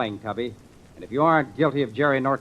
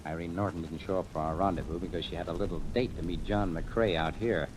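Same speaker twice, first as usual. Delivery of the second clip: low, hushed and much softer; 7 semitones lower, 8 dB quieter than usual. Acted. Detective briefing his men as they creep up, hushed. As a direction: murmur it so the houseboat can't hear. Sneaking up on the houseboat.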